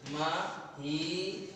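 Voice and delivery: महीद्र drawn out very slowly, each sound held; teacher slowly sounding out महीद्र; slow spelled-out demo. Shown as a demonstration. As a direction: stretch महीद्र out syllable by syllable. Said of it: "तो इसमें क्या लगा देंगे हम".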